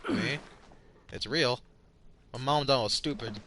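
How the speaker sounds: normal talking voice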